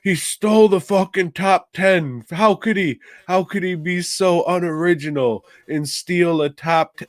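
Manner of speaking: high, whiny mock outrage; mocking offended friend. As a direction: whine it like a sore loser. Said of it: "he got mad that I was saying".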